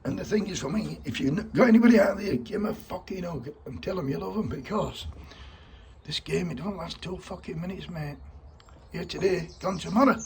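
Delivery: urging listeners while fighting tears; real emotion welling up in a heartfelt plea; getting emotional. Real, not acted. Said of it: "can feel me saying getting emotional".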